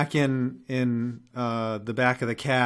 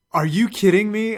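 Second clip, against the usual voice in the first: higher, louder incredulous mock outrage; retold outrage; 8 semitones higher, 7 dB louder than usual. Telling the cohost how he reacted to the cabbie, loud mock disbelief. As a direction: hit it like you can't believe it. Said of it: "And I'm like".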